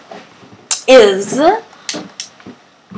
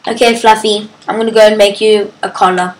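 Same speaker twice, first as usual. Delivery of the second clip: usual speaking voice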